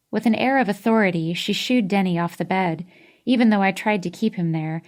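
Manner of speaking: ordinary delivery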